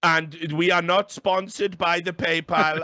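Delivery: slow, laboured mock lecture; imitating ESL producer. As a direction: drag out each point pedantically. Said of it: "twenty minute fucking lecture".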